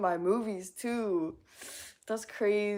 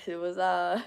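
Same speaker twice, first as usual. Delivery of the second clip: ordinary delivery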